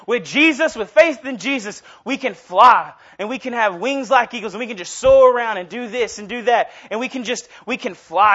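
penguin preacher preaching. Retold or imitated. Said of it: "He says".

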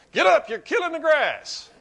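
hammed-up coach. Coach yells at the whining player, hammed-up and laughing. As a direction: bark it at him, laughing at the memory. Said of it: "they'd say things like".

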